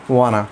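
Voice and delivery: wanna said as one word, WAH-nuh, no t sound; casual spoken wanna, WAH-nuh; wanna pronunciation. Shown as a demonstration. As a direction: run wanna together like a teacher modelling it. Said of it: "इसको जो है बिल्कुल इस तरीके से करना है".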